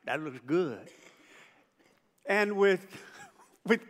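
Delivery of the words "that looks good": low admiring voice. Retold or imitated.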